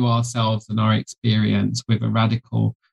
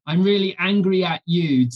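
raised, accusing angry tone; 7 semitones higher than usual. Acted. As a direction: throw the blame at them. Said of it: "it's the shift from".

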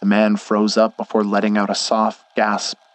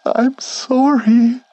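high, slow whimpered apology; whimpering; 4 dB louder, much slower than usual. Acted. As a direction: whimper it like a frightened child. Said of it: "he whimpered".

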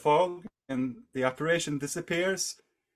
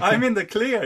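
high, loud, through laughter; laughing; 8 semitones higher, 8 dB louder than usual. Real, not acted. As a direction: crow it while laughing. Teller laughs at his own relief.

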